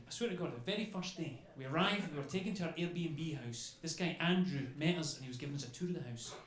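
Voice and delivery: ordinary delivery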